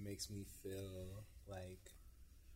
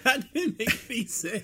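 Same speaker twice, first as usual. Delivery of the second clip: laughing; 19 dB louder, slower than usual. Real, not acted. Laughing at a silly answer.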